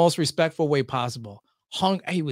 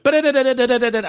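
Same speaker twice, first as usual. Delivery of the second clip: radio patter gibberish; 5 semitones higher, 4 dB louder, faster than usual. Shown as a demonstration. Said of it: "I went".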